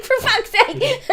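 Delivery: exasperated laughing. Retold or imitated. Neighbor voiced with a laugh.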